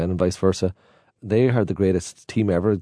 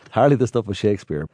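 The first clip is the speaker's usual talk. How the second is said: normal talking voice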